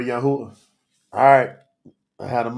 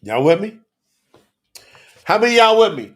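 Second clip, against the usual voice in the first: loud, high call to the audience; rallying call; 7 semitones higher, 12 dB louder than usual. Real, not acted. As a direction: rouse the crowd with a raised voice. Preacher rallying his live audience.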